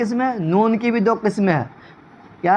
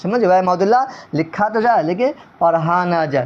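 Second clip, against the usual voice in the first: normal talking voice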